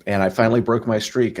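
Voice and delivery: ordinary delivery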